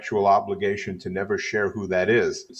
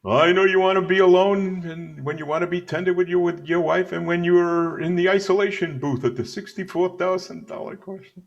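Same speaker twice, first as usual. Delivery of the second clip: Jackie Gleason; 9 semitones higher than usual. Acted. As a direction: raise the pitch and play the TV comedian. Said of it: "Remember that, Jackie Gleason?".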